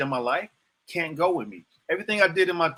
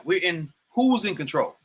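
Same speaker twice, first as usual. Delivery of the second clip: normal talking voice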